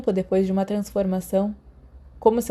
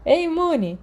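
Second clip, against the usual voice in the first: saudação animada; 9 semitones higher, slower than usual. Acted. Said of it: "James e Sirius falaram em coro".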